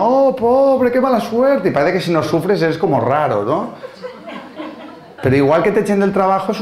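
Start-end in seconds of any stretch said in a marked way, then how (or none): 0.0-1.7 high-pitched gushing commiseration; mock sympathy from well-meaning onlookers; exaggerated pity. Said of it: "todo tu entorno".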